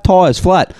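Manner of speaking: usual speaking voice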